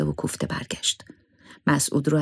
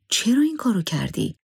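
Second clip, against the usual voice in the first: higher-pitched astonished question; surprised; 8 semitones higher than usual. A narrator's reading. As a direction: ask it in surprise, pitch leaping up. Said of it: "با تعجب پرسید".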